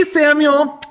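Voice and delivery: high sing-song classroom call; kindergarten teacher voice. Acted. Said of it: "One, two, three, eyes on me".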